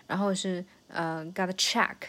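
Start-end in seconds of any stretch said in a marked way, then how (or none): 1.3-2.0 "check" punched after light "gotta"; stress on check. Shown as a demonstration. Teacher marking the second beat, stress on "check". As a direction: slide through "gotta", hit "check". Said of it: "这个check是重点".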